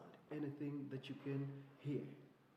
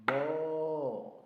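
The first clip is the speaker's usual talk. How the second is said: loud held moo; cow moo; 2 semitones higher, 10 dB louder than usual. Shown as a demonstration. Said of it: "sound of a cow".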